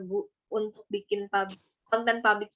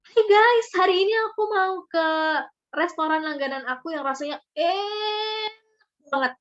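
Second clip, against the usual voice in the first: bright high "Hey guys" then emphatic review; excited vlogger opener; 6 semitones higher than usual. Shown as a demonstration. Demonstrating an excited food-vlog intro to listeners. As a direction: greet viewers like an eager food vlogger. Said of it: "Ada penekanannya".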